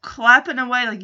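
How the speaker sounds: usual speaking voice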